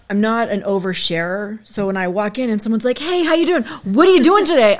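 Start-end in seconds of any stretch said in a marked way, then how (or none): 3.0-4.8 high, loud mock-chirpy hello; over-friendly greeting. Acting out a stranger greeting her as she walks in, over-friendly. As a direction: imitate a gushing stranger, pitched up and loud.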